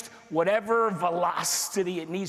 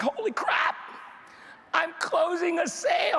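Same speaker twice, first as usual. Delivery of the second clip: inner excitement; 9 semitones higher than usual. Retold or imitated. Telling the room about his first closed sale, thrilled high voice. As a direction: voice jumps much higher, thrilled. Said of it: "on the inside, I'm like".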